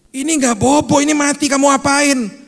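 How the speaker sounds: high, loud scolding dad; angry father. Retold or imitated. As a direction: yell at the kid who killed it. Father scolds child over hamster. (ayah membentak, keras dan tinggi)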